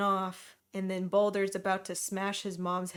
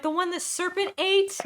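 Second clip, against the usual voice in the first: mock quote; 10 semitones higher, 4 dB louder than usual. Retelling characters' questions.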